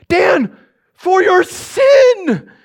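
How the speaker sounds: loud, high, very slow wail; moaning ghost. Acted. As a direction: stretch each word into a ghostly howl. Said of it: "Tony starts moaning".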